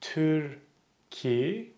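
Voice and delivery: Türkiye drawn out softly; slow Türkiye down syllable by syllable; slowly. Shown as a demonstration.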